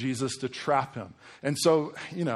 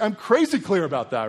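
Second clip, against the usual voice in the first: loud, high, insistent declaration; emphatic Jesus; 7 semitones higher, 7 dB louder than usual. Retold or imitated.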